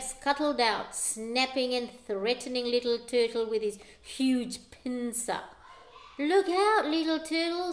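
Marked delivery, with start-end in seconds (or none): 6.2-7.7 high louder warning call; sung warning; urgent sing-song call. A narrator's reading. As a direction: warn him in song. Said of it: "sang the sea".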